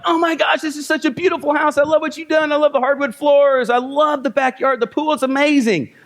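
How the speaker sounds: high, gushing overexcited buyer; overly enthusiastic gush. Retold or imitated. Agent mocking an overeager visitor with gushing praise. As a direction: gush over the house like a thrilled buyer. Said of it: "If you're overly enthusiastic and you come in and you're like".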